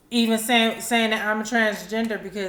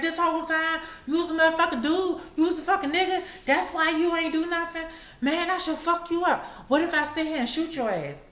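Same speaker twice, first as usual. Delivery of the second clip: mock furious boyfriend; 9 semitones higher than usual. Boyfriend raging after the reveal.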